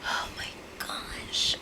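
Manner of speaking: a hushed, slow oh my gosh; disbelieving whisper. Real, not acted. Reacting in disbelief to apology.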